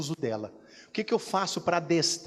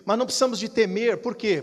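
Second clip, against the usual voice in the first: normal talking voice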